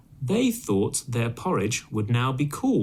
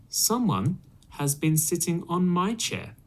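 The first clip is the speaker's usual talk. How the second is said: higher, gentler mother bear; middle-sized voice; 7 semitones higher than usual. Acted. Mother Bear noticing her cushion rumpled, higher mama voice. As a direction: lift the pitch into a mama bear voice. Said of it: "in a middle-sized voice".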